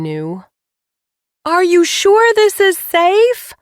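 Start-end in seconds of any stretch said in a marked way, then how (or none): 1.4-3.5 high, slow yell over engine noise. A narrator's reading. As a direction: shout it up over the roar. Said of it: "I yelled".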